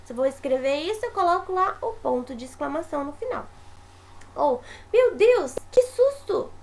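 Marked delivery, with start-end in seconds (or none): 4.9-6.5 high and loud, rising sharply on susto; startled exclamation. Shown as a demonstration. Teacher demonstrating a fright to show the exclamation mark, startled. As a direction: gasp it out like you just got a fright. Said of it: "Exclamação indica sentimento de susto".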